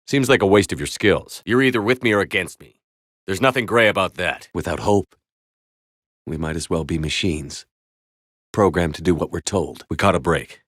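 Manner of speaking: ordinary talk, nothing marked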